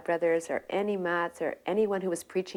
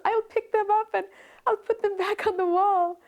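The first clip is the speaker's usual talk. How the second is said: high, anxious apologetic plea; pleading disciple; 6 dB louder than usual. Retold or imitated. Scolded young disciple to his guru, high nervous apology. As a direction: squeak out a nervous excuse, much higher.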